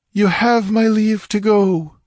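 high, loud, slow regal permission; imperious dismissal. A narrator's reading. False goddess dismissing the priests from her presence, imperious. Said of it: "with an imperious gesture of dismissal".

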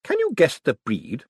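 put-on high, pleased old lady voice; approving old lady. A narrator's reading. Old lady approving of Moist, high and pleased. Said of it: "said Miss Lavish".

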